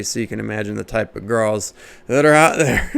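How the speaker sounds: ordinary delivery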